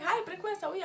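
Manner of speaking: lightly voiced cheerful parent hello; friendly parent greeting. Friend's parents greeting the student, light cheerful imitation. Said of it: "They'd be like".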